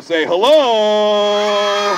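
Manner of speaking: long drawn-out call to the crowd. Acted. Performer to children who answered too weakly, calling out. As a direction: stretch the last word right out. Rallying a live audience to answer.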